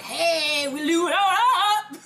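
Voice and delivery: high, loud hammed-up brag ending in a laugh; mock proud caption. Hosts imagining the listener's family photo, hammed-up high brag with laughter. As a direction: play the showing-off family. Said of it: "Send us a picture ... like".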